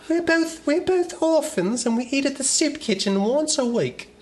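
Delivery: put-on very high, slow childlike voice; little English boy. Continuing as the orphan boy, very high. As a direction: chirp like a poor orphan. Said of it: "even younger".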